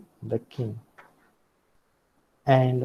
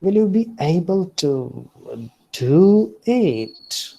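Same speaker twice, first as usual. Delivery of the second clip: higher and louder, rising then falling on "do it"; mocking doubt; 4 semitones higher, 4 dB louder than usual. Shown as a demonstration. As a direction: tease them, you doubt they can. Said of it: "someone is mocking".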